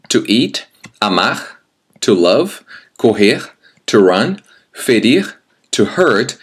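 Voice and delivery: usual speaking voice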